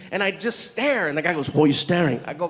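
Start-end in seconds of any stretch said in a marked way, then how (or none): 1.5-2.2 deep voice imitating a puzzled Indian man; Indian man's voice. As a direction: ask in a lowered, put-on voice. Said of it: "your voice doesn't match the".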